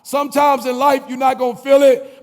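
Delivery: loud rhythmic preaching refrain. Acted. Speaker firing up graduates, full preaching. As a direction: preach it, building over the music.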